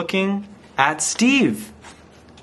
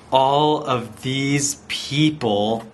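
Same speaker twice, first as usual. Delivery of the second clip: ordinary delivery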